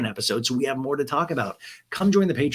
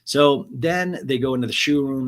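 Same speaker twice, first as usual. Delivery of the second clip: ordinary talk, nothing marked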